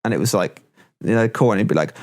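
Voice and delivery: normal talking voice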